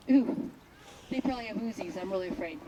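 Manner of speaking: sarcastic "so scared" from the dog; mock fear. Dog scoffing at the sheep, mock afraid and soft.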